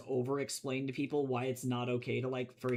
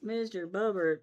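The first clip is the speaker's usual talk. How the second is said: calling the cat; 7 semitones higher, 5 dB louder than usual. Real, not acted.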